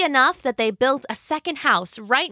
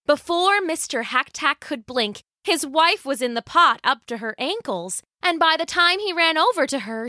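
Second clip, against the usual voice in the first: ordinary delivery, nothing marked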